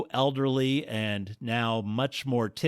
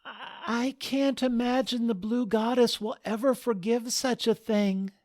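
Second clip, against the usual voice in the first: lightly voiced young bride, higher; a bride fretting over her goddess; worried bride; 13 semitones higher than usual. Penny speaks, fearing goddess.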